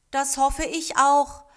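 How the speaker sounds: high, slow, doubtful agreement; not very convinced. A narrator's reading. Taichi answers the old man's promise of blossom, not very convinced. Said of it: "er klang nicht sehr überzeugt".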